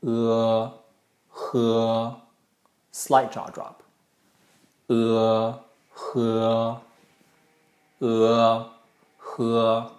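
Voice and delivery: "uh" met lichte kaakval aan het eind; "uh" en dan kaak iets laten zakken; slight jaw drop. Shown as a demonstration. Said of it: "jaw drops just slightly".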